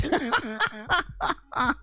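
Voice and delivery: high, slow, laughing exclamation; laughing oh my God. Real, not acted. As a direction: crack up and gasp it out. Laughing hard.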